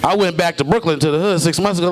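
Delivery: ordinary talk, nothing marked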